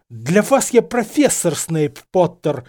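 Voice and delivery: slow, loud, emphatic rebuke; angry hiss. A narrator's reading. Snape correcting the insolent pupil, hissing angrily. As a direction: bite out each word in anger. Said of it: "прошипел Зельевар".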